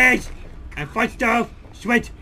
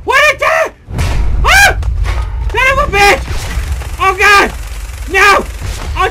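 panicked screaming; 8 semitones higher than usual. Real, not acted. Player fighting a huge monster, high panicked screams.

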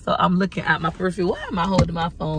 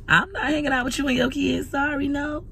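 sassy refusal; 8 semitones higher than usual. Retold or imitated. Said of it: "And I'm like".

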